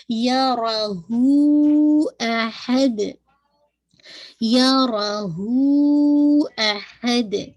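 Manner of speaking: यरहू with हू stretched for four to five counts; long madd recitation. Shown as a demonstration. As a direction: draw the हू out slowly, then अहद with qalqala. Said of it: "हू को आपने four to five count खींचना है". (हू को लंबा खींचकर यरहू)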